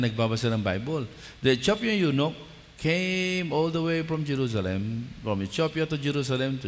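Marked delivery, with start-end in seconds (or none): none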